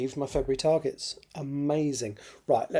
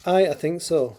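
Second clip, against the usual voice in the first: cheery Scottish aye, raised; Scottish accent; 4 semitones higher, 6 dB louder than usual. Acted. Mackenzie reassures Claire it went okay, in a Scottish accent. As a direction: answer with a Scottish lilt.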